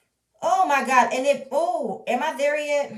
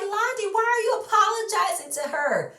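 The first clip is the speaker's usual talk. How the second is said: incredulous; 12 semitones higher, 3 dB louder than usual. Retold or imitated. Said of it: "I'm like".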